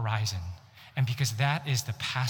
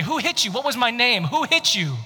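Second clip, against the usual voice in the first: high, louder jeering taunt; taunting mockery; 8 semitones higher, 6 dB louder than usual. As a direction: sneer it like a bully. Mockers slapping and taunting Jesus.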